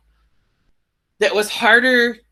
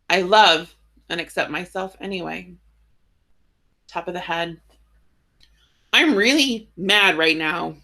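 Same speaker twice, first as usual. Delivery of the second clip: guided tapping script. Acted. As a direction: read each phrase calmly for the group to repeat. Leads a tapping script round.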